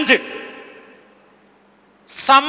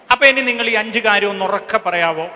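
ordinary talk

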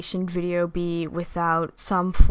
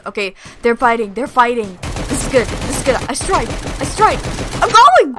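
climbs higher and louder to a yell; rising excitement to shout; 4 semitones higher, 8 dB louder than usual. Real, not acted. Gamer building from excited to shouting as she attacks. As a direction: build it up to the charge.

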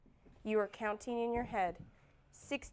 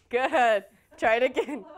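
loud, amused encouragement; laughing praise; 9 dB louder than usual. Real, not acted. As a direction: cheer them on through a laugh. Loud amused praise after the count.

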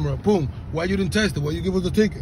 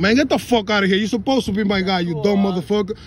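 ordinary delivery

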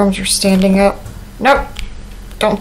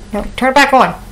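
ordinary talk

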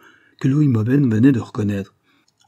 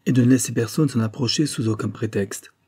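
ordinary delivery

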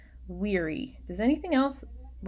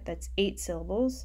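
usual speaking voice

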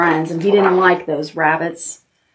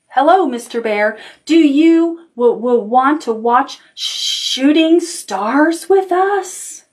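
high, slow, stammering little rabbit; nervous rabbit stammer; 6 semitones higher, slower than usual. Acted. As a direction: stumble over the w, scared to ask.

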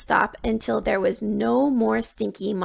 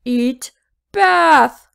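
booming beast shout; roaring monster; 3 semitones higher, 9 dB louder than usual. A narrator's reading. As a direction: bellow the word bath. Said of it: "roared the monster".

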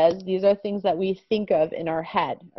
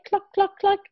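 high chicken cluck, much higher; 11 semitones higher than usual. Shown as a demonstration. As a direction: cluck like a hen. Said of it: "What's it saying in English?".

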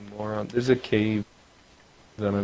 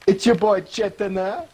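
put-on Jamaican accent; 9 semitones higher, 4 dB louder than usual. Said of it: "talking in a Jamaican accent".

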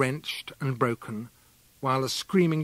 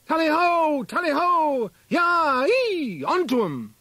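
high, loud huntsman's halloo; hunting cry; 13 semitones higher, 5 dB louder, slower than usual. A narrator's reading. Huntsman urging hounds on, a loud high halloo. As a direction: bellow it across the field like a huntsman.

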